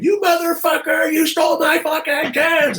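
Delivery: mimicking the sister's drunken phone rage; drunken furious rant. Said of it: "she'll call up and unload".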